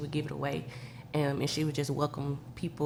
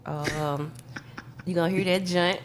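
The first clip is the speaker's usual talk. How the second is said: ordinary talk, nothing marked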